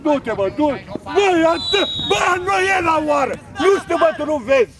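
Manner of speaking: high, loud sideline outburst; angry shouting. Real, not acted. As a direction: berate the players at full voice. Coach berating players. (strigăte nervoase, înalte și tari)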